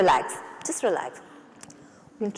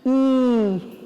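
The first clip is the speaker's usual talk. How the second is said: wide smiling ee; stretched ee; 2 semitones higher than usual. Shown as a demonstration. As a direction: stretch the lips into an ee.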